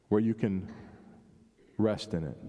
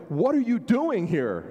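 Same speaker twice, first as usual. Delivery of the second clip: higher-pitched exasperated telling-off; parental scold; 5 semitones higher, 4 dB louder than usual. Acted. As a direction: scold a child caught making a mess. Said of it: "we hear it as a scold".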